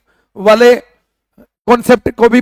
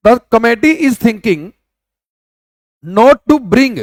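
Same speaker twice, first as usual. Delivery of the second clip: slow dictation; much slower than usual. Shown as a demonstration. Teacher re-reading slowly.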